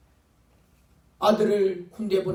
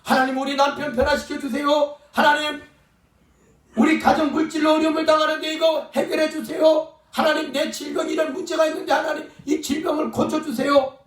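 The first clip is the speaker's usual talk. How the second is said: crying out in prayer; 5 semitones higher than usual. Acted. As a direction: shout the prayer up to God, begging. Preacher voices a desperate prayer.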